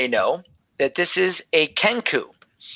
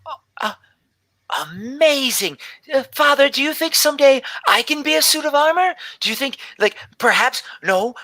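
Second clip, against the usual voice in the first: put-on much higher, breathless eager child; excited little girl; 8 semitones higher than usual. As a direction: beg her father with dreamy excitement. Gelby excited about armor.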